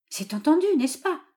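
high, insistent coaxing question; insistent father seeking a promise; pleading old father. A narrator's reading.

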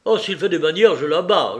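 loud, menacing warning; rough-tempered henchman's threat; growled threat. A narrator's reading. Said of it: "gronda Vaucheray".

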